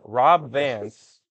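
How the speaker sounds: hammed-up car salesman pitch. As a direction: sell the name like a car ad. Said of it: "It honestly sounds like a car salesman".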